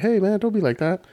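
high-pitched protest; hammed-up high plea; mock pleading. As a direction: raise it high, like pleading with a bully. Acting out a pleading remark.

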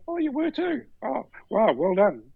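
very high imitated gasp of recognition, easing down; retold high-pitched admiration; impressed amazement. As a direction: coo it high in acted surprise. Police retold as suddenly impressed.

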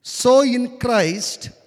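loud, slow preacher's reading; scripture reading. Acted. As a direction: proclaim the verse slowly and forcefully. Reads scripture to congregation.

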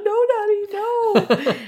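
put-on very high, loud child's wail; pleading little kid. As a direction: imitate a child begging Daddy to stop. Kids pleading as toys are binned.

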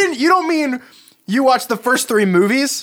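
high, loud incredulous voice; shocked disbelief. Pretend. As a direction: squeak it out in disbelief. Shocked at friend's confession.

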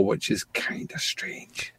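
hushed aside; under the breath; whisper. Real, not acted. Aside about strange desire.

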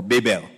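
"Babel" said with BAY as first syllable; alternative pronunciation. Shown as a demonstration. Preacher offering the congregation another pronunciation, "Babel" as BAY-bel. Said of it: "I don't know which school you went to".

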